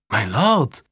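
put-on shocked young squire calling out; a brief, startled protest to his master; startled exclamation. A narrator's reading. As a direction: exclaim it in mild alarm. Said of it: "rief Alexander erschrocken aus".

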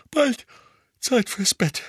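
high yawning mother. A narrator's reading. Mother hinting at bedtime after dinner, yawning. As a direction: say it through a yawn. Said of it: "sagte Misses Weasley gähnend".

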